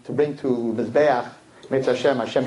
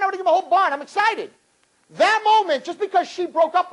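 rising preaching fervor. Acted. Preaching at full force.

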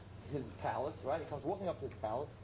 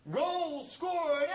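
much higher, louder stadium cheer; screaming fans; 14 semitones higher, 7 dB louder than usual. Acted. Soccer crowd celebrating a goal, screaming. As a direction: yell it like the whole crowd just scored. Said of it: "and they're screaming".

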